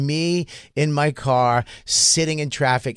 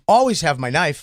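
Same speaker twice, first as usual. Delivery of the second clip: ordinary delivery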